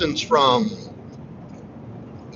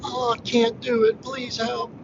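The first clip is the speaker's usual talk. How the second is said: higher, breathy-free mock damsel plea; mock damsel in distress, pitched up; helpless damsel; 7 semitones higher, slower than usual. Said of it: "playing the innocent damsel in distress".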